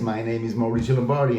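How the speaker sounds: usual speaking voice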